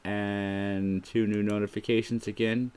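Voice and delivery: ordinary talk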